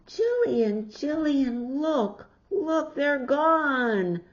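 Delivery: put-on high, loud, urgent child's call; excited calling. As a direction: call out to Jillian in alarm. Child calls out alarmed.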